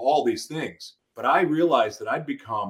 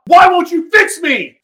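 very high, loud anguished shout; furious desperate cry; shouting at God; 12 dB louder, slower than usual. Retold or imitated. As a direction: scream it up at God in despair. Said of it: "as I'm cursing at God".

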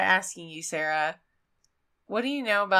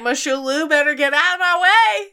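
higher and louder, hammed-up urgency; mock dramatic escape; 7 semitones higher, 6 dB louder than usual. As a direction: shove past like a panicked passenger. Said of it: "You'd be like".

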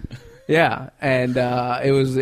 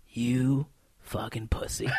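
menacing whisper; 2 semitones lower, 6 dB quieter, slower than usual. Retold or imitated. Mentor on the phone, slow menacing whisper. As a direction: whisper the insult like a threat. Sweet mentor suddenly insults him.

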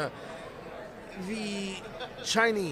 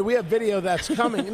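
ordinary talk

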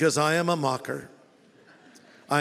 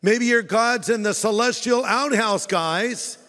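a lightly higher sarcastic jeer; mocking jab; 5 semitones higher, 4 dB louder than usual. Elijah making fun of Baal's prophets, sarcastic. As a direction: rub it in with a grin. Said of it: "making fun of them".

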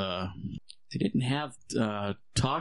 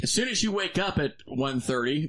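laughing mock order; 5 semitones higher than usual. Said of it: "I'm like".

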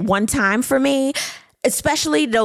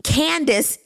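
ordinary delivery

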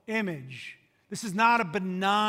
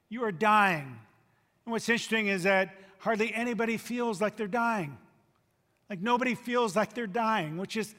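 usual speaking voice

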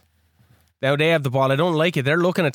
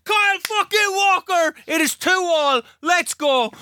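high, elated goal celebration; jubilant shout; 10 semitones higher than usual. Real, not acted. Player celebrates his equaliser, jubilant shout. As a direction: yell the scorer's name and celebrate.

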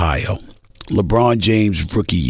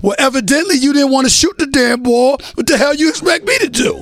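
indignant retort. Retold or imitated. Iverson indignant punchline in story.

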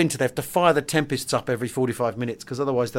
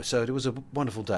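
softer, quicker, understated reading; considered, sat back; 4 semitones lower, 9 dB quieter, much faster than usual. Shown as a demonstration. Podcaster showing his reading of a considered French pilot, sat back and understated. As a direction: read it lightly, sitting back from it. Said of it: "I've tried to sit back on it a bit more".

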